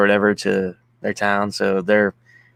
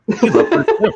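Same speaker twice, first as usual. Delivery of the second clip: teasing line broken by a laugh, pitched up high; laughing tease; 12 semitones higher, 4 dB louder than usual. Real, not acted. Teasing the co-host about loving Bruce, laughing. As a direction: laugh through the jab at the co-host.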